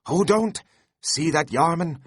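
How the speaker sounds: high, loud, giddy pleading to the rowers; Flask excitedly urging. A narrator's reading. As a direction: beg the crew to pull, hopping with glee.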